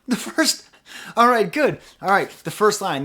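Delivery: gasp, then high, loud delight; excited discovery. Real, not acted. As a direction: burst out as if the test just worked. Delighted that the line scans.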